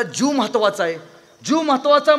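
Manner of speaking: loud, high cry for dear life; acted-out shouting in fright; panicked yelling, retold. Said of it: "जोरदार ओरडा लागलं".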